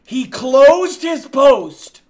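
high, loud, drawn-out indignation; slow, incredulous yell; outraged shout. Real, not acted. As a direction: yell it slowly in disbelief. Outraged at being rejected.

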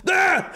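high startling yell; jump-scare yell at the kid; sudden high yell to scare; mock scream. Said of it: "burst open the door and go".

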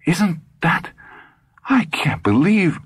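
high, slowed woman's voice, stunned; shocked Monica. A narrator's reading. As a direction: Monica fumbling for words in disbelief.

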